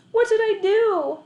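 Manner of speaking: high, loud, slow distressed question; elderly neighbour fretting aloud; dismayed old lady. A narrator's reading. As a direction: wail it as the upset old woman. Neighbour dismayed after dog escapes.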